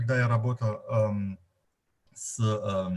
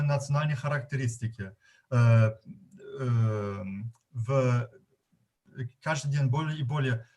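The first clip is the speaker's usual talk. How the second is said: Russian with a German accent; non-native Russian, German accent. Real, not acted. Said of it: "если мой русский не очень нормативный".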